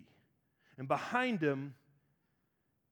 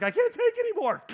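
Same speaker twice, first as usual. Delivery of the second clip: much higher, louder exhausted whine; mock worn-out whine; 7 dB louder than usual. Tired kid in Walmart, mock exhausted whine. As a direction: whine like a kid dragged around. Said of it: "Some of you just shut down".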